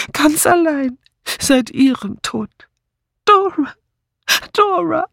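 high, grief-stricken lament; sobbing. A narrator's reading. Charlotte confessing and mourning Dora, sobbing. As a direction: wail in grief, pitch climbing as it goes. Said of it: "erklärte noch immer schluchzend".

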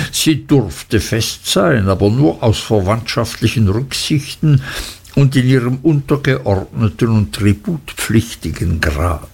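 usual speaking voice

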